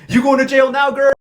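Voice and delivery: loud, high-pitched gloating; gleeful taunt. Retold or imitated. Relishing that she's going to jail, loud gleeful taunt. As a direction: crow it at the top of the voice.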